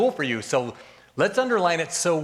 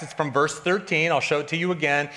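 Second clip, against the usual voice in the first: normal talking voice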